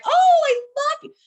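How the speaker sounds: very high, loud squeal of delight; squealed thanks. Retold or imitated. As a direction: squeal it like a thrilled fan. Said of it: "gushing reply to a compliment".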